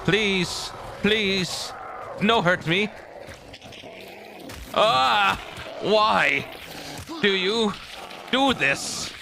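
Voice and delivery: high, pleading, begging voice; scared horror game streamer. Pretend. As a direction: beg the monster for mercy. Begging the monster.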